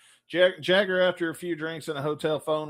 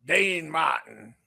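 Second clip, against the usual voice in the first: [name] impression; much slower than usual. Shown as a demonstration. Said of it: "impersonation of each of these".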